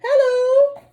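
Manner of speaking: high squeaky echo hello; high distant answering call. Acted. Echo reply across water.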